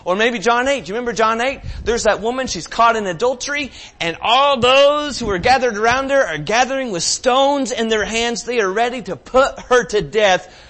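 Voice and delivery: loud, high preaching build; impassioned sermon; preaching at full force. Acted. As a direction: drive the story home to the congregation.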